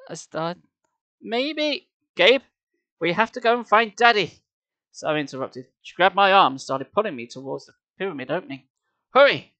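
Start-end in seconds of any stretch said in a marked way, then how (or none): 1.2-4.4 high, louder urgent pleading; urgent girl. A narrator's reading. Girl urging. 9.1-9.5 high loud hurry; urgent shout. A narrator's reading. Urging shout.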